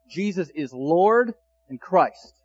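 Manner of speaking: each word set down slowly, Lord leaned on; slow proclamation. Acted. Pastor declaring Peter's message to listeners, slow emphatic proclamation. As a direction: proclaim it like the heart of the sermon. Climax of sermon summary.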